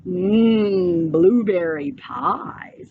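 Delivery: a greedy bear asks about dessert, low and slow; big bear. A narrator's reading. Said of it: "said the bear".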